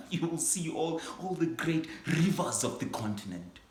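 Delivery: grand, swelling excitement; rising excitement. Acted. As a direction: sweep them up in the wonder of Africa. Teacher's vision swells with awe.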